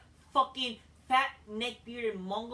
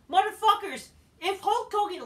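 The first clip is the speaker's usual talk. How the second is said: high, loud angry outburst at the fans; ranting shout; 11 semitones higher, 8 dB louder than usual. Real, not acted. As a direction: yell it out in frustration.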